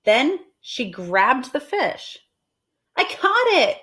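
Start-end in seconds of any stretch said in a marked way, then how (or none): 2.9-3.8 high, loud triumphant cry; excited yell. Acted. Amelia Bedelia shouting to the family, high and excited. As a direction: shout the catch out proudly. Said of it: "she yelled".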